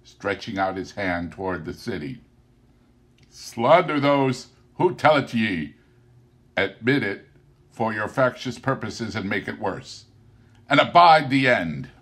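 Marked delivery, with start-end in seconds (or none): 3.3-11.9 stern warning. Acted. Spirit's angry warning continues.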